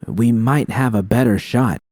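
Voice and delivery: plain reading voice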